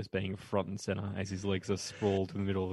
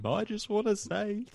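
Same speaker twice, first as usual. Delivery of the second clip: mock dramatic farewell; 12 semitones higher, slower than usual. Mock dramatic exit quote.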